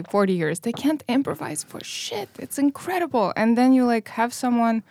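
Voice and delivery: ordinary talk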